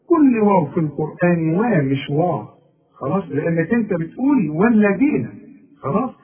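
usual speaking voice

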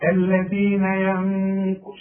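slow ينق with a nasal ن held two beats. Shown as a demonstration. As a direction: hum the ن in ينق. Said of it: "لازم تغنها". (غنة حركتين)

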